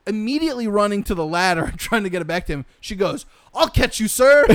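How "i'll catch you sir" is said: high, loud hammed-up call; mock calling out. Janice to man hanging from the ladder, hammed-up shout. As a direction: call up to him, higher and louder.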